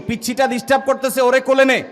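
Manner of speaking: loud, high and hurried irritated order; scolding shout. Retold or imitated. Elder ordering the boy to carry the baby, loud and high. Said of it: "এক ধমক দেয়".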